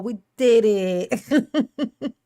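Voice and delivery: slow, laughing through it. Real, not acted. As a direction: savor the win laughing.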